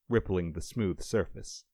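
ordinary delivery

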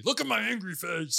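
hammed-up, unthreatening monster voice; friendly monster. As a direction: play angry like a harmless monster. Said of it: "you sound like a friendly monster".

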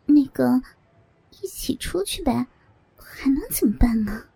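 hushed, putting on composure; feigned calm. A narrator's reading. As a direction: act composed in a near whisper. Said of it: "故作镇定".

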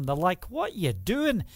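ordinary delivery

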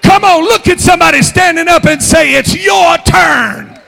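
loud, sung-out preaching cadence; a preacher calling the church to shout, at full force. Acted. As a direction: whoop it to the congregation.

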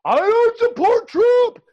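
high, slow, drawn-out mock voice; mocking whiny denial. Mocking Trump-voting Biden haters with a high whiny denial. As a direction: sneer it out in a silly high voice. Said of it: "they'll say all day long".

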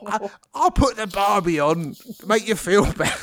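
put-on a light Australian accent offering comfort; hammed-up Aussie host, lightly done; mock Australian. Friend parodying Neighbours, lightly put-on Australian accent. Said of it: "To sound very Australian".

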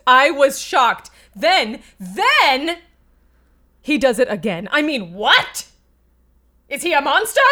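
rising to high anguished wails; grand tragic wailing. Acted. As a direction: build from shock to shrill despair. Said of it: "in character".

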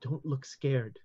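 hushed, careful warning close to the ear; Madam Lin whispering a careful warning in the guest's ear. Acted. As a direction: warn them softly so no one else hears. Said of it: "she whispers very carefully".

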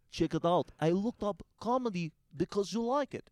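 high mock Russian accent. Russian date showing him comedy, high mock accent. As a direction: put on her high Russian voice. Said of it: "'cause she was Russian".

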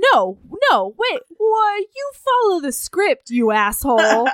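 hammed-up indignant protest; pissed off. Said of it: "she gets kinda pissed".

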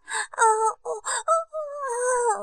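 high drawn-out moan; rising string of acted moans; acted pleasure moans. Narrator acts character's pleasure.

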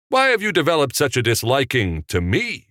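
high-pitched pointed question; challenging echo. A narrator's reading. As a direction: throw the line at him as a challenge. Director challenges executive, echoing youth.

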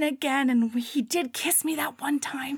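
hushed secret whisper. Retold or imitated. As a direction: whisper it like gossip. Gossipy secret about the book.

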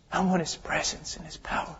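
soft, unvoiced plea; whispered longing. Preacher whispers his longing for God's presence. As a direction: breathe it like a prayer.

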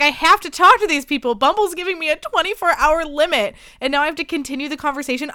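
panicked rant about having to keep chatting on Bumble; frantic panic. Retold or imitated. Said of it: "I was like".

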